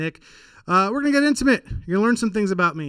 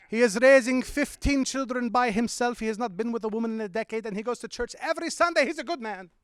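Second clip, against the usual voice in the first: put-on higher, proud bragging accent; Middle Eastern uncle accent; 5 semitones higher than usual. Uncle bragging about his son, Middle Eastern accent. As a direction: boast like a proud immigrant uncle. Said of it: "He's like".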